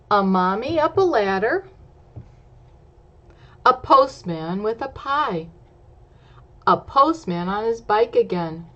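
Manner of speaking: lively picture-book rhyme; story narration. A narrator's reading. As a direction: read the rhyme brightly to children. Reads rhyming story text aloud.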